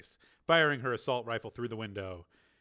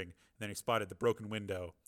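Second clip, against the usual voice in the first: ordinary delivery, nothing marked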